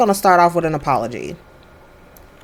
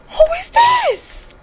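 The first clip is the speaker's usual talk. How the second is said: very high, drawn-out squeal of shock; startled shriek; slower than usual. Real, not acted. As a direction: squeal it slowly in shocked surprise. Startled by unknown game character.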